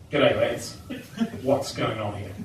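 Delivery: a friendly-but-knowing officer pulling up; policeman's greeting. Retold or imitated. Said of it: "and we hear".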